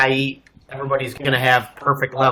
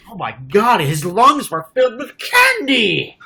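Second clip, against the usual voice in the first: mock shock; 8 semitones higher, slower than usual. Mock shocked autopsy discovery.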